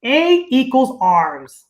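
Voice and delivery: each word drawn out slowly for learners; slow, deliberate instructor call; slow teaching demo. Shown as a demonstration. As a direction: spell it out slowly like a dance teacher. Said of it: "and we'll say".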